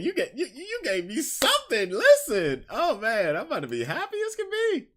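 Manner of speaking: can barely contain the delight; high laughing giddy voice; giddy excitement. Real, not acted. Excited about opening hotel stuff.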